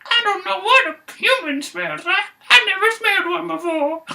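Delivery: put-on very high childlike voice; baby bear voice. Baby bear puzzled about human smell, very high. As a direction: talk like a tiny cub. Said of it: "the baby bear's like".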